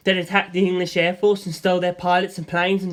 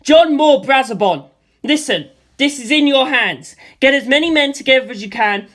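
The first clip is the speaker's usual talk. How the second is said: louder urgent yell; yelled down the line; 6 semitones higher, 7 dB louder than usual. A narrator's reading. Narrator shouts orders to his friend down the phone line. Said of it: "So I yelled down the line".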